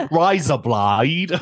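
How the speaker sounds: a jokey fake Australian, drawn out; bad Australian accent. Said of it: "Australian accents the whole episode".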